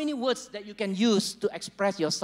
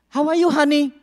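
higher, louder wife's question; wife asking; 6 semitones higher, 8 dB louder than usual. Retold or imitated. Pastor voicing a wife asking her husband, higher and louder. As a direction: ask brightly like a wife. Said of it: "You know".

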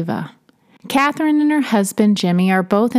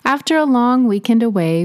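ordinary delivery, nothing marked

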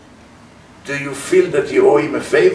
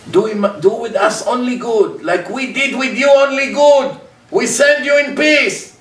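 high mock voice of Avimelech's men; 8 semitones higher than usual. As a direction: put on their falsely generous tone. Avimelech's people voiced mockingly.

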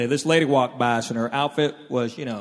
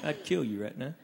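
hushed threat; 3 semitones lower, 11 dB quieter than usual. Retold or imitated. Wife's secret threat over sleeping husband.

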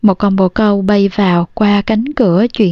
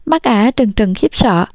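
plain reading voice

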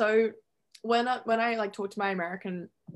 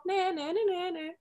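taunting; 9 semitones higher than usual. Pretend. Teasing taunt at short friend.

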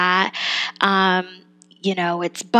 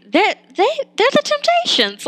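high-pitched, louder burst of discovery; excited realization; 4 dB louder than usual. Retold or imitated. Reviewer retelling how she caught the band reference, excited realization. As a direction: blurt it out high, re-enacting the sudden realization. Reenacts spotting the names' reference.

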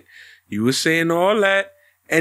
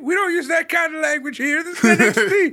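mock scolding; 10 semitones higher, faster than usual. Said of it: "acted scolding figure".

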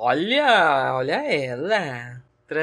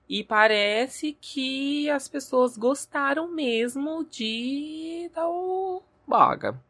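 mocking high voice; 9 semitones higher, slower than usual. Mocking drawn-out voice.

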